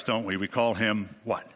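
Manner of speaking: ordinary talk, nothing marked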